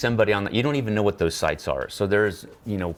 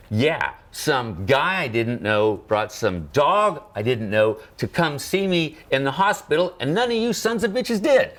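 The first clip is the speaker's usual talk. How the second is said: starts slow, then climbs much higher and louder to the last words; building indignant outburst; 8 semitones higher, 3 dB louder, slower than usual. Retold or imitated. Patient telling off his coworkers, building to a higher, louder outburst. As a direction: let it build to a fed-up, triumphant cry.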